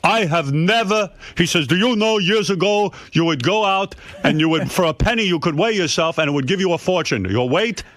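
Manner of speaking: hammed-up know-it-all father; imitating father. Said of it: "He was like".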